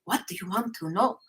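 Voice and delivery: put-on quick little accented question; brisk put-on accent, lilting up at the end; Metatron's accent. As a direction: lightly imitate an accented spirit teacher. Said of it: "with this little".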